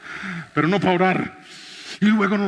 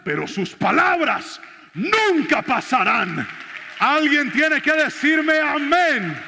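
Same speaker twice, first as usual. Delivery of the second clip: preaching climax; 7 semitones higher, slower than usual. Acted. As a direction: stretch each word and call the church to answer.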